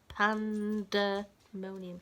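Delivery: Pandemonium in five slow separate beats, each syllable clapped; clapping out syllables. Shown as a demonstration. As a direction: say Pandemonium very slowly, one clap per syllable. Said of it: "Shall we clap it?".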